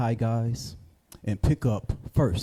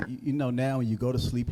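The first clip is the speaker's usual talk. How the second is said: normal talking voice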